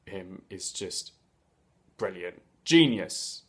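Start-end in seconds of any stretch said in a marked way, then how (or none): none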